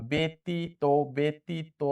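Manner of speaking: ব্যতীত slow, syllable by syllable; correct pronunciation demo. Shown as a demonstration. Teacher checking a quiz option aloud, slow syllables. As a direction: split ব্যতীত into three slow beats. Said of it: "সঠিক".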